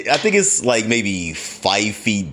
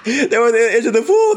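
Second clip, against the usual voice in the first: usual speaking voice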